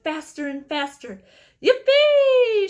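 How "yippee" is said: very high, very loud whoop of glee; joyful shout. A narrator's reading. Grandpa Joe as the elevator shoots up, joyful whoop. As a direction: whoop like an old man on a rollercoaster. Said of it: "shouted Grandpa Joe again".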